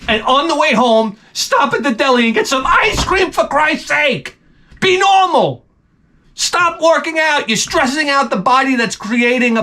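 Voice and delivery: high-pitched mock tirade, slowed on the shout; high, exasperated scolding; mock rant. Yelling at pregnant gym women.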